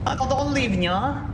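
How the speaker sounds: put-on high playful cat-girl plea; cutesy nya speak. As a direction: whine it cutely like a cat. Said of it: "me acting like a cat".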